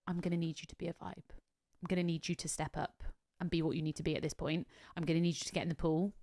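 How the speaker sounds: quietly enlisting someone; confidential instruction. Retold or imitated. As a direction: keep it soft, like a secret briefing. Quietly recruiting guests.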